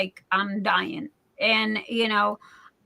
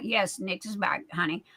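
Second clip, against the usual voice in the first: tender reply; 5 semitones lower, 8 dB quieter than usual. Real, not acted. Answering the fan tenderly.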